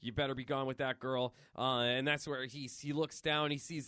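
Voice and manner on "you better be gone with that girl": low muttering to himself; lightly voiced old hero, pitched down; Logan. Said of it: "Logan's talking to himself there".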